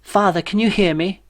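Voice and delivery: high, anxious voice of a weedy son; timid young son. A narrator's reading. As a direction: lean in and ask him, nervous and high.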